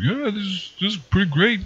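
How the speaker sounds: high, soft imitation of the guy; imitated high voice. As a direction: squeeze the voice up high, half mocking. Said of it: "There's this dude who's like".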